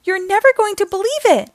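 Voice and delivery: high, loud and thrilled; excited. Acted. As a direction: burst out with big news. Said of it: "she calls excitedly".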